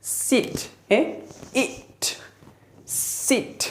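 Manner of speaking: सिट sounded out softly as s, it, sit; sounding out sit. Shown as a demonstration. Teacher sounding out sit for students. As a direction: break sit into parts, short i. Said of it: "तो कैसे pronounce करेंगे आप".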